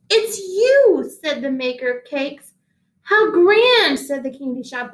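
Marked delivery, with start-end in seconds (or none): 0.1-1.1 higher, delighted "It's you"; happy surprise. Acted. The cake maker delighted to see the uncorker arrive. 3.0-4.0 higher, louder, thrilled "How grand"; delighted exclamation. Acted. The candy shop owner thrilled by the uncorker, louder and higher.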